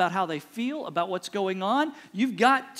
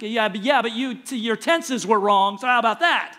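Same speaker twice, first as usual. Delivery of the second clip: louder, mocking nitpick of her grammar; petty comeback; 6 dB louder than usual. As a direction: sneer it like a know-it-all husband. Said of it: "it's like".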